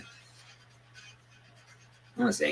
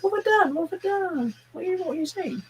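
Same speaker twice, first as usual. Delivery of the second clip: high-pitched mock protest; put-on high voice, playing the innocent; mock chat reply. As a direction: squeal it like a bewildered friend butting in. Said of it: "He'll pop in the chat and be like".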